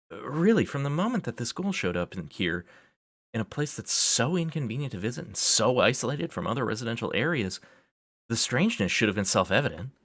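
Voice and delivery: high-pitched, incredulous reasoning; worked-up exasperation. Acted. Worked-up rant carries on.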